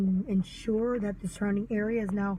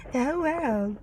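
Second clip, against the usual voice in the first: ordinary talk, nothing marked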